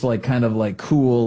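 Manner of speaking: ordinary delivery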